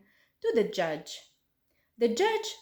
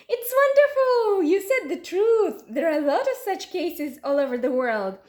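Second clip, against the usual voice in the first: high, loud and fast cry of delight; girl cheers the fat man's lie, high and loud; delighted shout; 6 semitones higher, 5 dB louder than usual. A narrator's reading. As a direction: shout it out, mock-amazed. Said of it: "and shouted".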